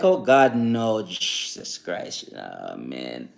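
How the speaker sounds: sinking into a low, soft groan; acted weary dismay. Speaker recalls negative people coming near, mock dismay. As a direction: dread the company, trailing down low and slow.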